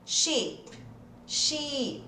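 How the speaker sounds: Sheep with a long, stretched "ee"; exaggerated long vowel. Shown as a demonstration. Teacher exaggerates so students can identify it, long ee. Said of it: "exagerando aqui só pra você identificar".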